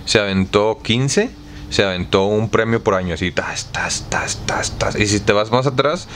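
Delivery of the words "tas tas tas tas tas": soft, whispered rapid-fire hits; whispered sound effect. Pretend. Host counting off yearly prizes, whispered sound effect. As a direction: whisper it like prizes landing one after another.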